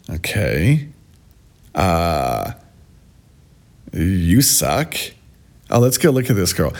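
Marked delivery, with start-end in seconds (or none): none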